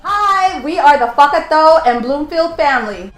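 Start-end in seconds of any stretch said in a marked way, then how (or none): none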